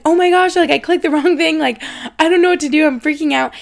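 high, loud, frantic retelling of a panic; panicked. Retold or imitated. Retelling to listeners how she panicked over the beeping watch, high and frantic. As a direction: replay the moment the alarm went off, voice up and loud.

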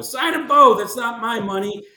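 sports radio caller. Retold or imitated. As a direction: play a brash radio caller demanding the signing. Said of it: "You sound like one of our sports radio callers".

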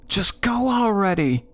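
high-pitched holler from a frantic sister; high, urgent sister's yell. A narrator's reading. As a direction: holler it high, urging them on. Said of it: "my sister hollered in my ear".

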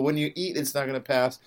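ordinary talk

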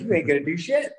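high-pitched mock dismissal; scornful retort, pitched well up; retold taunt. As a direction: imitate a scoffing comeback, amused.

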